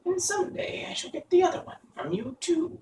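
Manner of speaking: very high-pitched, taunting witch; mocking witch. A narrator's reading. Witch threatens to get the other shoe, taunting. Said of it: "laughing at her".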